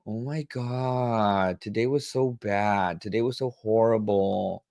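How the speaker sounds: slow, stretched-out whiny words; dramatic drawn-out complaint. Shown as a demonstration. Mocking a dramatic friend with stretched-out whining. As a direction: drag every word out to sound dramatic. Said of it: "you say stuff long ... stretch 'em out".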